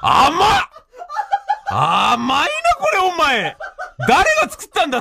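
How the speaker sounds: tasting the ice, rising mock amazement. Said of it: "っつって".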